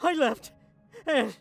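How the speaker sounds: high, tearful, voice breaking; sobbing it out, losing control; crying breakdown. Acted. Lamar telling the knights what was taken, in tears.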